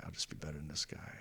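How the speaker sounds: unvoiced whisper, fast; whispered vow. Telling himself he'll win her back, whispered. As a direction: whisper it to yourself.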